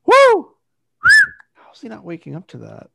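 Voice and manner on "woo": loud high whoop to wake him. Real, not acted. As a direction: whoop right at his ear. Trying to wake sleeping friend.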